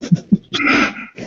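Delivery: breathy laugh. Real, not acted. Laughing at own joke.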